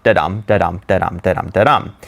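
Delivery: low, even run of da-dum, stressed on DUM; iambic rhythm. Shown as a demonstration. Teacher showing how five feet sound, low da-DUM rhythm. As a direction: tap out "da-dum" low and regular. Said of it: "that sound like".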